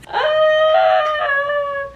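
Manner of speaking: high unvoiced cackle then squeal; real laughter at the memory; high laughing squeal. Real, not acted. Laughing at own story.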